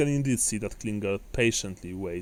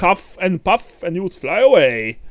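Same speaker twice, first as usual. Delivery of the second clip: loud, higher boisterous dwarf; 4 semitones higher, 10 dB louder than usual. Acted. Dwarf teases manling about huffing and puffing, boisterously. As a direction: tease loudly as a proud dwarf.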